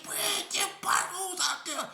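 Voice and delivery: high, slow, urgent warning; panicked warning. Retold or imitated. Bursting in to warn Bread about the gang: panicked. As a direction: sound the alarm in a strained high voice.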